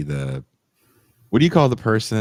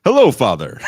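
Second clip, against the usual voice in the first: loud, higher, mock newsreader greeting; hammed-up formal anchor greeting; anchor-like kids; 6 semitones higher, 11 dB louder than usual. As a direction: imitate a kid greeting like an anchor. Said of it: "Your kids talk like, uh, anchor people".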